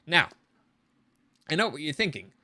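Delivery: ordinary talk, nothing marked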